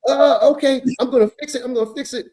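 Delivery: high, hurried mock capitulation; cowed teacher. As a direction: imitate a rattled man backing down. Cowed teacher agreeing to fix it.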